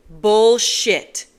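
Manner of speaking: loud, cutting shout; angry interruption. Acted. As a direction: cut her off hard. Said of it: "cutting her off".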